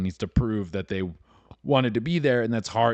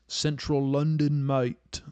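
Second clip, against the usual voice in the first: put-on slow, higher-pitched English accent; put-on English accent, dragged out; slightly better English accent; 5 semitones higher, slower than usual. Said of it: "I guess, a little better".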